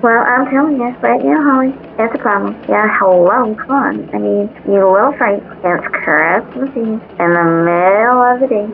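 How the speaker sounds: irritated elf. Acted. Frustrated elf ranting.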